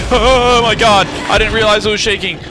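high, loud panicked yelling; startled shout. Real, not acted. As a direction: yell out in sudden fright. Startled by shaking ladder in game.

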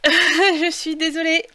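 sorry said through laughter; laughing apology. Real, not acted. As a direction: laugh off the hiccups. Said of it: "J'ai le hoquet".

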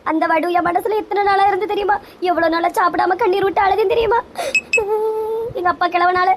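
high-pitched weepy complaint; acted crying, breaking into sobs at the end; tearful lament. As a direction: sob through the grievance. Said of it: "அழாதுங்கம்மா".

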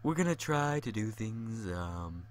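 hammed-up seductive murmur into the mic; mock sensual voice. Shown as a demonstration. Gamer trying a sensual voice for the audience, mock soft and low. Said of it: "a little bit more sensually".

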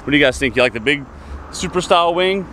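ordinary delivery

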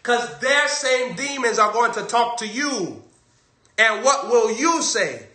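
loud, high preacher's warning; preaching at full force. Acted. Preacher warning the congregation, loud and high. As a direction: hammer the warning home to the congregation.

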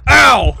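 high, loud "ow"; loud yelp. Real, not acted. Gamer as the character trips, loud yelp. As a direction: yelp sharply as if hurt.

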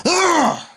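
sharp, high exasperated ugh; frustrated groan. Real, not acted. Angry vlogger groaning at the reboot news, high and sharp. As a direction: let out a fed-up groan.

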